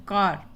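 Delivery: कार with only a light R at the end; soft final R. Shown as a demonstration. Teacher showing learners not to stress the R, कार with soft R. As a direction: say कार, barely sounding the final R. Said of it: "रा ज़्यादा नहीं बोलना है".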